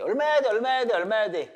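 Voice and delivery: loud, high rapid-fire questioning; urgent quizzing. Real, not acted. Teacher pressing students for the answer to an example, urgent quizzing.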